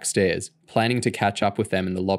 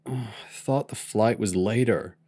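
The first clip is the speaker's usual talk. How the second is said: moaned; 4 dB quieter than usual. A narrator's reading. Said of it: "Curtis moaned".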